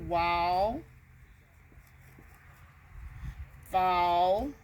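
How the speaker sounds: "Wall" and "fall" stretched on the shared -all ending; drawn-out rhyme. Shown as a demonstration. Teacher showing the same ending sound, "Wall" and "fall" held long. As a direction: draw out the rhyming end of "Wall" and "fall". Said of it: "they have the same ending sound".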